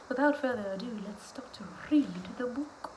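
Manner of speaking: hushed whisper before the story. Real, not acted. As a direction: whisper it like a secret. Whispered lead-in.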